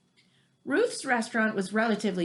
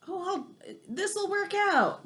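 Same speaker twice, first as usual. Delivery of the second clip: a carefree, higher "it'll be fine" voice; breezy hurried self; 9 semitones higher, slower than usual. Retold or imitated. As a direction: toss it off like you're in a rush. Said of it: "this part sounds like me a lot because I'm always in a hurry".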